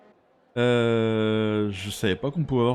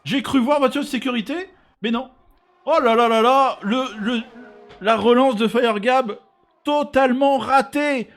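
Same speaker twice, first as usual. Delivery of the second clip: lively, raised play-by-play; animated race commentary on the replay; motorsport commentator; 13 semitones higher, 7 dB louder than usual. Real, not acted. (commentateur sport auto animé)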